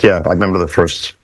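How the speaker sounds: ordinary delivery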